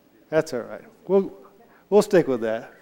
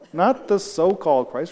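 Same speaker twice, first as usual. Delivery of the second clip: usual speaking voice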